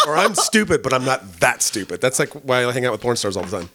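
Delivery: normal talking voice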